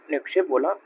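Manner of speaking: ordinary delivery, nothing marked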